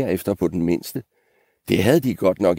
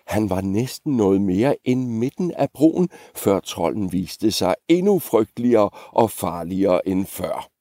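plain reading voice

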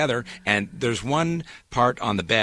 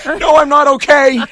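exaggerated panic; 13 dB louder than usual. Retells panicked answer to employee.

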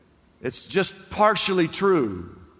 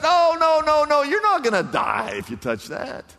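sly Satan; 11 semitones higher than usual. Pretend. Satan voiced, coaxing the woman.

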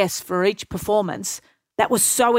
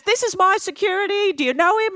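raised, lively introduction in her voice; Oprah impression; 10 semitones higher than usual. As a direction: introduce him brightly, pitched up. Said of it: "She goes".

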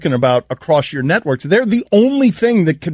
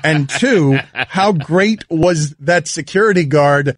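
usual speaking voice

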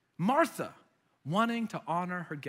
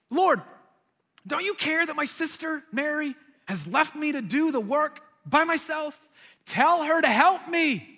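frustrated plea; 8 semitones higher, 4 dB louder than usual. Retold or imitated. Martha complaining to Jesus about her sister, frustrated. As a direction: appeal upward, then drag out the loud order. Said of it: "like, get frustrated".

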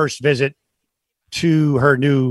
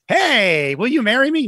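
mock proposal; 9 semitones higher than usual. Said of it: "you're like".

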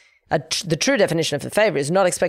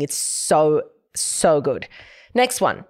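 ordinary talk

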